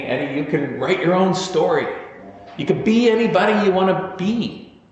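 encouraging teacher. Retold or imitated. Teacher encouraging young Eddie.

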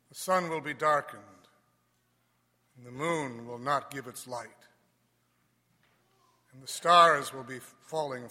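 solemn Gospel proclamation; cathedral echo. Acted.

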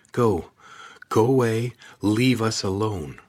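frightened shout. Acted. Kate threatens creature, hand shaking.

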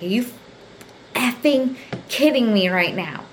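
mock outrage, mildly voiced; retold indignation. Retold confrontation in the hallway.

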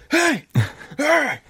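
high mock yell hey; imitated road-rage yell. Retelling an angry driver yelling at the car ahead. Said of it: "yelling at this guy".

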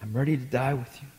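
soft, low, quick pledge; quietly acted devotion; hushed vow. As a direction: murmur the promise quietly.

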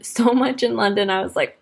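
ordinary talk

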